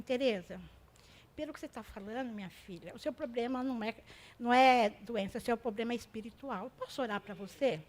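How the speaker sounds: soft, low, gentle reassurance; quiet caring words to a weeping woman; tender counsel. Retold or imitated. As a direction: speak softly to comfort her. Speaking gently to crying woman.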